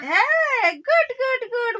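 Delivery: excited praise. Real, not acted. Praising toddler's right answer.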